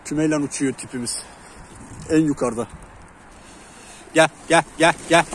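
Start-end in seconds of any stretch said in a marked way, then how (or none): 4.1-5.2 loud repeated coaxing call; calling the bird. Real, not acted. Keeper loudly calling his pigeon back to the roof.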